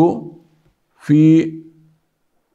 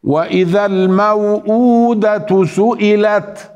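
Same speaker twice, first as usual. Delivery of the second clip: slow, measured tajweed chant; Quran recitation; 4 semitones higher than usual. Shown as a demonstration. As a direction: recite with held vowels and nasal hums. (تلاوة مرتلة بالتجويد)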